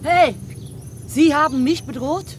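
outraged. Acted. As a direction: call them out, voice shooting up. Outraged retort to a threat.

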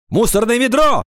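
high, piercing shouted exclamations; piercing cries. A narrator's reading. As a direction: yell out single words over a noisy class. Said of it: "вылетали пронзительные крики". (пронзительные выкрики)

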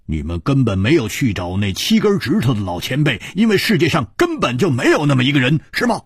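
quick challenge climbing in pitch; accusing interrogator Hu. Acted. Hu confronting the couple with their lie, accusing. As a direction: build the accusation to a high, sharp question.

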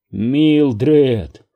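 ordinary delivery

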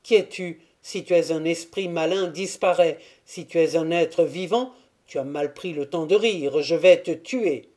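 a startled guard's warning; shaken cry. A narrator's reading. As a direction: cry out, half scared, half threatening. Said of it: "s'écria d'une voix altérée".

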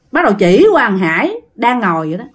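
plain reading voice

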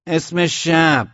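slow, high, loud challenge; sentry's shout. A narrator's reading. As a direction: keep shouting, dragging out each word. (داد کشدار نگهبان)

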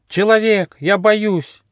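high-pitched scared little koala; alarmed high animal voice; frightened cry. A narrator's reading. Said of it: "вдруг испуганно закричал".

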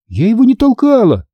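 high-pitched indignant denial; outraged child. A narrator's reading. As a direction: protest your innocence hotly. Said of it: "возмутилась я".

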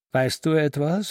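goaded. A narrator's reading. Ambros to Salomon after nearly slapping him, stirred up. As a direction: demand it, a touch stirred up. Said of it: "fragte er aufgestachelt".

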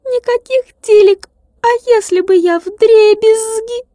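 a furious little glass man; angry tiny glass man. A narrator's reading. Tilka angry, scared of breaking. (сердитый стеклянный человечек, высоко и звонко)

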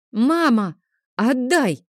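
put-on small boy demanding his sword from his mother, high and loud; child demanding. A narrator's reading. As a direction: whine for it back. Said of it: "потребовал Лео".

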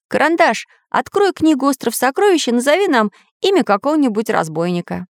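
high-pitched, lively character voice; eager story character. Acted. Samodelkin asks his friend to open the book, eager high voice.

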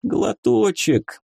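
high, drawn-out begging; weak soldier's lament; wailing plea. A narrator's reading. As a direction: stretch the word out pitifully. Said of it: "продолжал причитать солдат".

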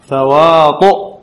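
تواطؤ drawn out slowly, rising at the end; slow questioning demo. Shown as a demonstration. Teacher quizzing students on the vowel, slow rising. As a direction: stretch تواطؤ and lift it like a question. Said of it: "هنا كانت الطاء إيش؟".

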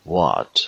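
"What" said loud with a fully rounded W. Shown as a demonstration. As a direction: round the lips completely to start "What".

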